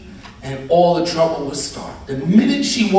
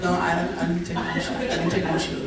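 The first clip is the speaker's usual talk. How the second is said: softly imitated guilty kid; meek denial; 7 dB quieter than usual. Kid lying to grandmother, mock meek denial. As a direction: play a child quietly denying it.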